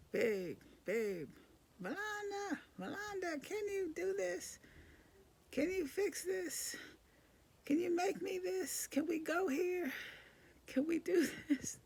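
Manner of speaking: whiny, needy husband asking over and over; mimicking husband. Mom mimics her husband's constant requests, whiny high voice.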